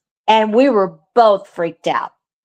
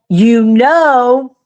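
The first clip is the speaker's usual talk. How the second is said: retold sleep yell; 4 semitones higher, 5 dB louder than usual. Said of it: "sound asleep and yelling".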